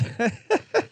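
short high giggle; high laugh. Real, not acted. Laughs at bad beat.

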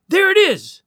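high, loud call; excited discovery. A narrator's reading. Woman sighting the rocket, calling out excitedly. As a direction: pipe up on spotting it.

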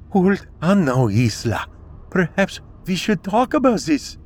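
high, nervous, pleading; trembling as you try to stay calm; quivering voice. A narrator's reading. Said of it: "Katerina's voice quivered".

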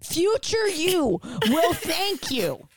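overacted sales pitch; hammy ad read. Mock hammy podcast ad plea.

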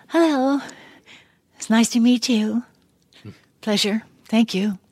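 showing the voice she used when meeting the casting people; audition voice. Retold or imitated. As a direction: play it sweet and polite to win the room. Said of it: "I talked in this voice".

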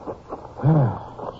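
a long, weary breath out; tired sigh. Acted. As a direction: sigh out the fatigue of the long walk. Tired from walking the field.

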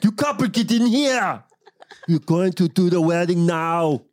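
high, strained Austrian wedding officiant; Arnold Schwarzenegger. Acted. Conducting a wedding, high exaggerated Austrian accent. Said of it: "He's, like, conducting a wedding".

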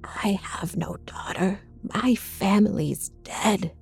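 slow, breathy rejection; spat. A narrator's reading. Mother curling away from daughter, spat. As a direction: spit it out slowly, in a hushed voice. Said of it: "she spat".

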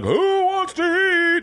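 high, slow, drawn-out call; mock yell. Calling the animals to eat, high drawn-out mock yell. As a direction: call them to dinner across the house. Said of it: "Like, I'm yelling".

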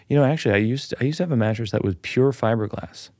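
ordinary talk, nothing marked

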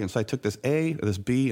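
usual speaking voice

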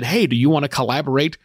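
ordinary delivery

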